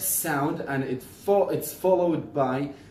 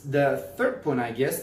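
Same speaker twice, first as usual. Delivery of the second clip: normal talking voice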